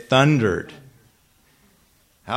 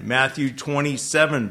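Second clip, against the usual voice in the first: ordinary talk, nothing marked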